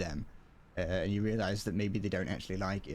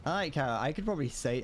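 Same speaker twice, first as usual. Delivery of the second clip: usual speaking voice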